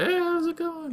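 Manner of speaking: very high, sweet mock greeting; high cousin voice. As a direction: squeak it out like a girly cousin. Cousin voiced in retold drama.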